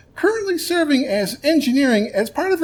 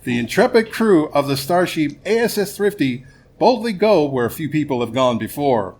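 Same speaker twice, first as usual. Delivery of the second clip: show narration; 6 semitones lower than usual. Acted.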